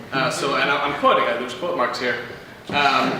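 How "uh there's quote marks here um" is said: ordinary talk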